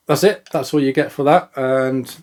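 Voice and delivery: ordinary delivery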